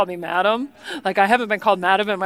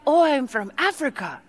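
higher-pitched, badly faked African accent; mock African accent; 4 semitones higher than usual. Hotel tea lady tells guest her origin, mock African accent. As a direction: imitate her African accent, admittedly nothing like it. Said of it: "how to do her accent".

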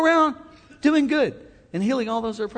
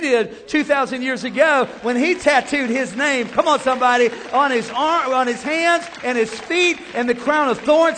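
rising, ringing sermon delivery; full-force preaching; 7 semitones higher, 3 dB louder than usual. Real, not acted. Preacher driving the crowd toward amen. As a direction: preach it hard to the congregation.

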